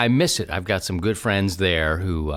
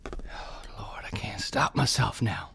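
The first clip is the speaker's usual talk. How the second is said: voiceless whisper, much softer; whispered aside; 9 dB quieter than usual. Real, not acted. Host whispering to himself that he can't stop, voiceless whisper. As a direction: breathe it as a guilty aside.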